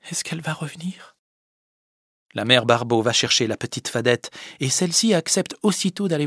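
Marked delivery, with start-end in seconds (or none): 0.1-1.2 put-on whispered, higher, weak sick boy asking; faint whisper. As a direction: breathe it out like a sick child. Sylvinet malade demande la fadette. (murmure chuchoté d'enfant malade)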